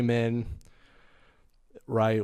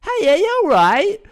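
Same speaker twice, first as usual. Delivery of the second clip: high, loud British lilt run into one word; British greeting; 11 dB louder than usual. Acted. Comedian greeting a shop in England, exaggerated British accent. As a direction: chirp it in an exaggerated British accent, much higher and louder. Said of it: "I learn the lingo".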